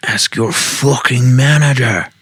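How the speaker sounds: French chef's yell, pitched high; hammed-up French-accented outburst; screamed French accent. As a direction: cry out in a put-on French accent. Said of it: "And screamed".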